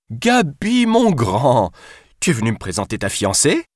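appel aigu et plus fort à travers le couloir; héler Gabi au bout du couloir; crier de loin. Acted. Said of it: "lui cria-t-elle de loin". (cri joyeux lancé de loin)

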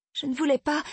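voix d'enfant très aiguë; fillette effrayée. A narrator's reading. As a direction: se défendre d'une petite voix haut perchée. Fillette se défend devant père furieux. (enfant prise de panique)

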